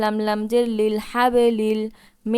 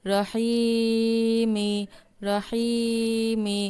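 stretched Quranic recitation, syllables drawn out; slow recitation; much slower than usual. Shown as a demonstration. Teacher modelling the word slowly for students. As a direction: draw each syllable out like tilawat.

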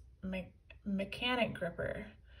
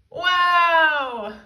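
incredulous laugh; 7 semitones higher, 14 dB louder than usual. A narrator's reading. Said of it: "laughed Big Tippy incredulously".

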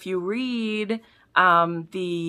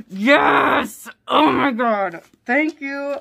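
louder, higher cry of joy; thrilled; 6 semitones higher, 7 dB louder, much slower than usual. Real, not acted. Fan unwrapping a longed-for gift, thrilled cry. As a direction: cheer at the gift.

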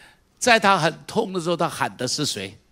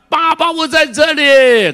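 much higher and louder, slow shout; loud cry to God; 12 semitones higher, 11 dB louder, slower than usual. Shown as a demonstration. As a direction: cry out with all your strength. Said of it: "大声的喊".

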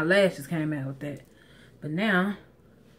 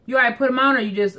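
raised voice across the yard; calling out; 5 semitones higher, 7 dB louder, faster than usual. Real, not acted. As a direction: call out to someone outside. Calling out to husband at grill.

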